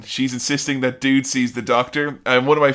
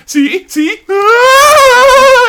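high, very loud mock shriek; 12 dB louder, much slower than usual. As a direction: shriek it like she's panicking on the phone. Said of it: "She's like".

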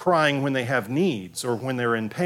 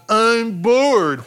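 much higher and louder, whining "I'm bored"; imitating a bored kid's wail; whiny child; 9 semitones higher, 8 dB louder than usual. Shown as a demonstration. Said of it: "whiny noise".

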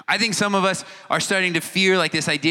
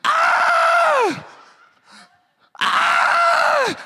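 very high, loud mock screams; screaming bloody murder; 4 dB louder, much slower than usual. Someone afraid of time and gravity, shrieking nonstop in mock panic. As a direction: shriek in terror again and again. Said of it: "walking around screaming bloody murder".

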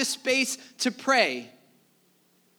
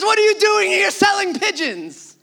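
angry Jesus; 8 semitones higher, 6 dB louder than usual. Acted. Jesus overturning the vendor's table.

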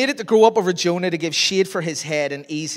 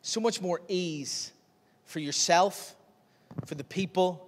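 normal talking voice

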